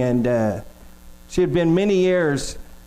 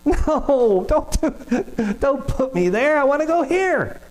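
much higher, pleading mock whine; whiny child; 8 semitones higher, slower than usual. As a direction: beg like a kid refusing to go. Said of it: "I'm just gonna whine and complain more".

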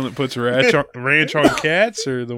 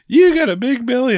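put-on higher, louder kid's taunt; pitched-up mock child voice; mock little daughter; 10 semitones higher, 6 dB louder than usual. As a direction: imitate a daughter teasing her dad. Daughter teasing dad in a story.

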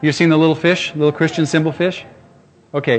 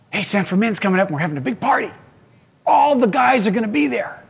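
higher, fast, eager party-pal voice; buddies' invite; 7 semitones higher than usual. Retold or imitated. Old friends calling him up, an eager party invite.

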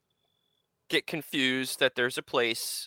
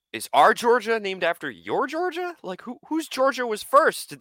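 higher, louder baffled questions; confused outrage; 10 semitones higher, 4 dB louder than usual. Retold or imitated. Puzzling over the map, indignant. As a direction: demand answers, baffled. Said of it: "I'm looking at the map and I'm like".

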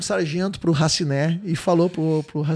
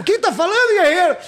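mock sergeant bark; 10 semitones higher than usual. Sargento responde gritando.

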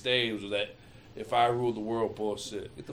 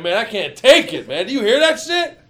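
loud, high laughing outcry; high shouted disbelief; overwhelmed shout; 11 semitones higher, 17 dB louder than usual. Retold or imitated. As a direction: blurt it out, too much to take. Retold overwhelmed outburst.

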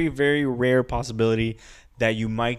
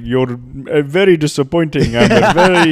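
loud disappointed friend; 3 semitones higher, 10 dB louder than usual. Retold or imitated. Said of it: "he was like".

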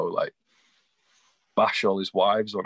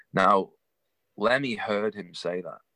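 ordinary delivery

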